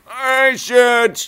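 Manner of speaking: high, loud retort; second self voice. Acted. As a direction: snap back as another self. Self dialogue again.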